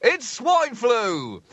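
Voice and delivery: high, loud, drawn-out newsreader proclamation; mock headline announcement. Podcast host announcing top story, mock headline bellow. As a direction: trumpet the headline, stretching the last words slowly.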